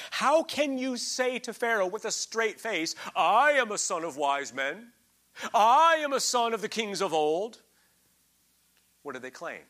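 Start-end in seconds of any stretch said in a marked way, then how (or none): 0.1-7.6 preaching scripture. Acted. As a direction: declaim each word like a prophet. Reading scripture with full force.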